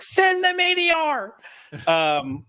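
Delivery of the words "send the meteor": high, slow, grand proclamation; dramatic proclamation. Acted. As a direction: call it out like a battle cry. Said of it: "We're just gonna be like".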